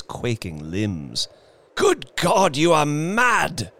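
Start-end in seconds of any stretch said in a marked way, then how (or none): 1.8-3.7 high, loud horrified cry; horrified at her idea; aghast cry. Acted. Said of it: "he cried, aghast".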